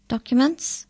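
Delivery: high, official demand. A narrator's reading. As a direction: demand papers like a border officer. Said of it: "he demanded".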